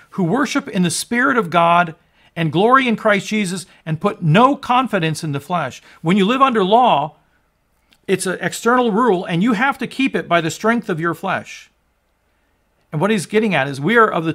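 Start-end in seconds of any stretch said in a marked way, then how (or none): 0.1-11.7 louder and higher, building to a roaring proclamation; full-force preaching. Acted. Preacher to his congregation on the paid debt, building full-force preaching. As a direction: preach it out, swelling with every line.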